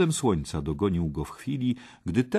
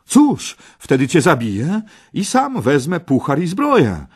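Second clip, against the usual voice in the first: high, loud youthful threat; defiant exclamation; 10 semitones higher, 11 dB louder than usual. Acted. Parsival threatens the red knight, crying out high. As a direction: cry out your threat boldly. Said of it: "wykrzyknął Parsival".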